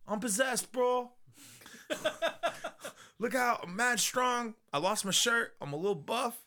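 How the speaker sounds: high-pitched hammed-up bragging; possessed skinny guy. Voices the possessed skinny character.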